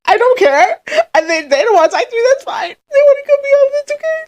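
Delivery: high, tearful self-imitation; mock crying. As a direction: squeak it out like holding back tears. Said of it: "what I look like when I'm crying".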